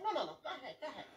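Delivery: high, laughing no no go ahead; imitating a too-relaxed driver; mock cool driver. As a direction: wave him through, all easygoing. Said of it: "He's like".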